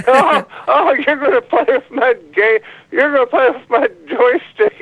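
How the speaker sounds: high-pitched, hammed-up kidnapper gloating; mock creepy teasing. Caller pretending to kidnap the host, mock creepy teasing.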